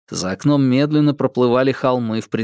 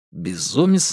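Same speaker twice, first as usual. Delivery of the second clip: ordinary delivery, nothing marked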